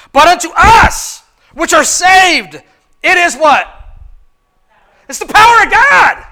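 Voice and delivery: slow, high, loud build to a shout; preaching at full force. Acted. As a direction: drag it out then blast it. Preaching climax.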